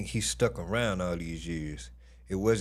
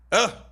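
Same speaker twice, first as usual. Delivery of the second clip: excited yelp; 11 semitones higher, 6 dB louder than usual. Real, not acted. Reacting to pictures.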